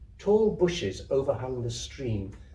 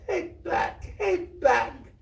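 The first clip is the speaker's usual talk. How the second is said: high sobbing plea, half breath; little girl sobbing into the man's legs; much slower than usual. Acted. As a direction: sob the words out. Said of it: "and sobbed".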